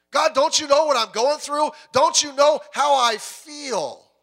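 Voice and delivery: loud and high, the last line slowed; anguished plea. Acted. A troubled believer crying out to God, loud and pleading. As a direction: cry up to God in frustration.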